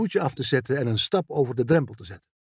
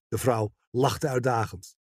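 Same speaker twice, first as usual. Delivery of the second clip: ordinary delivery, nothing marked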